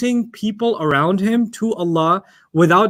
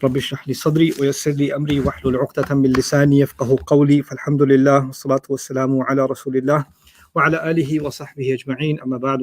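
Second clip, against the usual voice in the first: rapid, even Arabic recitation; opening supplication; 3 semitones lower, much faster than usual. Real, not acted. Teacher opening a lesson with prayer and praise, recited rapidly. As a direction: run through the formulaic prayer briskly.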